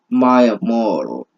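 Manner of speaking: usual speaking voice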